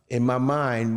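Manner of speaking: ordinary talk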